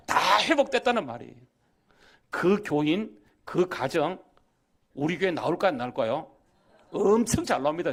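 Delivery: loud, punchy pulpit delivery; emphatic preaching. Real, not acted. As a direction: drive each phrase home to the congregation. Preacher drives home the testimony.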